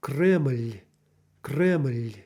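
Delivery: "Kreml" with a small vowel before the L; syllabic final L. Shown as a demonstration. Teacher demonstrating final L, syllabic in Russian. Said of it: "Again, a final L".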